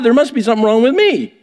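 ordinary talk

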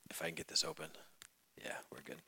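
unvoiced whisper to himself; whispered aside. Real, not acted. Preacher whispering to himself while fumbling with a bottle.

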